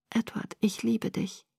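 very soft, hushed declaration; tender farewell. A narrator's reading. Girl facing death thinks of Edward, tender hushed farewell. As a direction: breathe it out as last words of love. Last words of love before dying.

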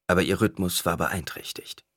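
ordinary delivery, nothing marked

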